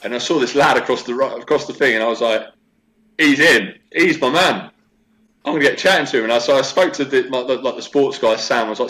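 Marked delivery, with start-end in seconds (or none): 3.2-6.2 thrilled and a little louder, pitched higher; eager, higher and louder; excited. Retold or imitated. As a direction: talk it up like you've spotted your new gym partner. Excited inner thought about lad.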